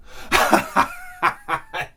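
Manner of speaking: real laugh at the absurd neighbor; high laugh. Real, not acted. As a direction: burst of high-pitched laughter. Laughing at the story.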